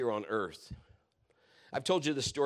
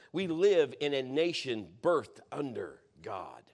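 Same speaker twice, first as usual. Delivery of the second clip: usual speaking voice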